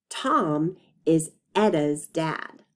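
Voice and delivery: slow, stretched teacher read; stretched sentence. Shown as a demonstration. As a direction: say it slowly for the class. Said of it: "The first word we're gonna stretch is".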